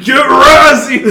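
slow, loud hammed-up shout; mock battle shout. Razzie charging the bed in the blooper, loud mock shout. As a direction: bellow it like a brute charging.

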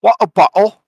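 high, loud mock Cockney; Cockney British accent. Co-host adding his other British party piece, loud Cockney accent. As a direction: punch it out as a hammed-up Cockney catchphrase. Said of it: "It's got the Cockney".

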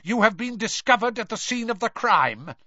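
high, forceful denunciation; manic accusation. Acted. Mister Crouch, eyes popping, to Ron, manic accusation. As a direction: accuse them like a fanatic. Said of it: "He looked slightly mad".

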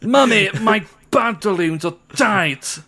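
high, slow, put-on grand whine to mummy; hammed-up pampered child. Child tells mummy of tight pantaloons, hammed-up high voice. Mock-posh riff continues.

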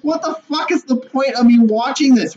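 high, indignant rant; exasperated outburst. Retold or imitated. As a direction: blurt it out in disbelief. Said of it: "I was so mad".

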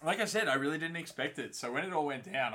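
ordinary talk

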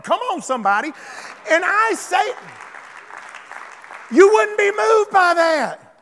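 high, loud preacher shouting God's words; full-force preaching. Acted. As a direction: thunder it out to the congregation.

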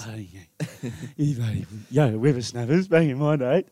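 lightly voiced grumpy old-timer, laughing; a hint of a cranky old codger; old prospector. Mock old-timer grumbling, laughing.

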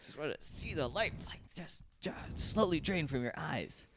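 cute aggression, soft and strained; overwhelmed by cuteness. Shown as a demonstration. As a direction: coo with menace at something adorable. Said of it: "it's like cute aggression".

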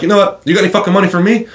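high-pitched, fast retold challenge; heated comeback. Roofer turns on a begging man, heated retort.

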